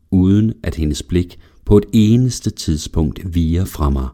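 usual speaking voice

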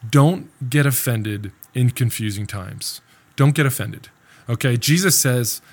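usual speaking voice

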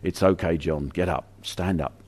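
softly, a lightly voiced reassuring Jesus; gentle reassurance. Jesus reassuring fallen John, soft and gentle. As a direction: hush him up off the ground. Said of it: "And Jesus has to say".

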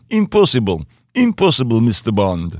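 coached Russian-accented villain line. Shown as a demonstration. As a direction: put on a deliberate Russian accent. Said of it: "do a Russian accent".

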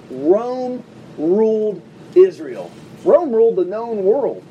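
each word laid down slowly and heavily; slow, grave emphasis on Rome ruled; slow weighty stress. Real, not acted. Preacher stressing Rome's power, slow and heavy.

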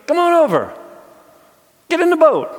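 very high, loud holler; calling out. Pretend. Disciple inviting Jesus into the boat, calling out. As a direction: beckon someone over with a big shout.

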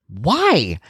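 mock exasperation at a kid's refusal; baffled dad. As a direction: ask it like you can't fathom it. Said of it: "I can't fathom it".

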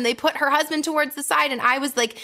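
usual speaking voice

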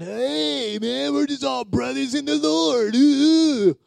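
high, slow, smug mock voice; mock know-it-all. Preacher mocking a know-it-all churchgoer, high and smug. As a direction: lampoon a cocky know-it-all, drawn out and high. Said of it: "know-it-all attitude".

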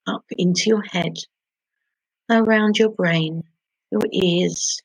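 normal talking voice

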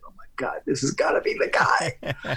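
breathless hushed whisper; awed whisper. Retold or imitated. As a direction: whisper it in awe as the guy arrives. Awed realization told to hosts.

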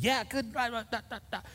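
high, soft squeaky babble; mock grumbling. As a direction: trail into small, high-pitched complaining noises. Retelling his own grumbling to stranger.

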